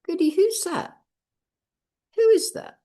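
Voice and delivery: high-pitched, amazed question, retold; astonished questioning. Speaker asks Goody about the picture on the wall, astonished.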